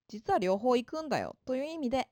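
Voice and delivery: ordinary delivery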